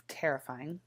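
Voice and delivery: ordinary talk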